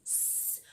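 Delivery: a long, very soft unvoiced "S" hiss; hissed S sound. Shown as a demonstration. Teacher repeating the S sound for kids, barely audible hiss. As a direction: let the "S" hiss out almost silently. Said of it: "o som do S é esse".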